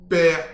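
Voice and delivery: Père hit hard and loud; the key word Père, said loud and firm; stress on Père. Shown as a demonstration. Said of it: "Père Osiris".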